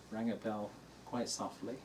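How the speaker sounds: usual speaking voice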